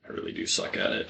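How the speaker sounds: quick whispered aside; muttered aside. Real, not acted. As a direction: mutter it fast to yourself. Self-deprecating aside.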